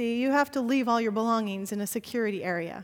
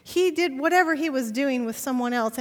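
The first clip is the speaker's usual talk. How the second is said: normal talking voice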